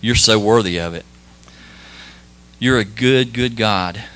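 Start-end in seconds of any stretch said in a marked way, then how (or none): none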